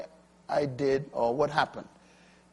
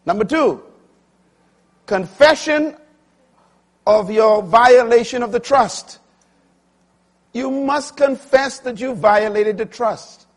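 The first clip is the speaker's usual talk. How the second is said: high, loud pulpit proclamation; full-force preaching; 8 semitones higher, 9 dB louder than usual. Acted. Preacher announcing the second point, full-force preaching.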